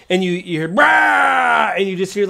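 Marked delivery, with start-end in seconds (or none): 0.7-1.7 loud, high goblin roar; imitated goblin scream. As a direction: yell out a wordless battle scream. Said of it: "like, some screaming".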